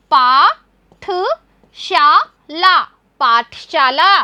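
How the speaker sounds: पा, ठ, शा, ला spoken slowly, then पाठशाला blended; sounding out words. Shown as a demonstration. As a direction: build पाठशाला syllable by syllable. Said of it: "ल में भी आ की मात्रा".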